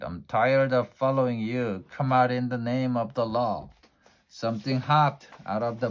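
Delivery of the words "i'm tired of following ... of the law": loud, raised call into the fog; firm lawman's shout, louder. Acted. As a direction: shout it out like a policeman. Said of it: "At last he shouted".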